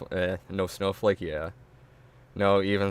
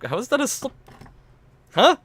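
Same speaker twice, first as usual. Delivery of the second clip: incredulous; 6 dB louder than usual. Real, not acted. Incredulous at excuse.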